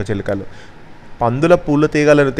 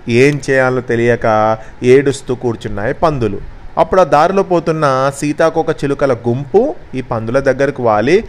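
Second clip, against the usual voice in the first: ordinary delivery, nothing marked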